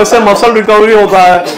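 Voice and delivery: lightly mimicked gym guy selling supplements; hint of a know-it-all trainer's sales patter; gym trainer pitching protein. Gym trainer lecturing teacher on nutrition, lightly mimicked pitch. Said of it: "वो मुझे nutrition पढ़ा रहा था".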